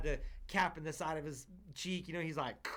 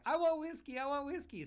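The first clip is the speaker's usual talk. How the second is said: imitating a small boy begging his mom; whiny little kid; 11 semitones higher than usual. Dad imitating the neighbor kid begging his mom for whiskey, high whine. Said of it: "So everyone's like".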